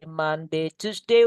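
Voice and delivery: ordinary delivery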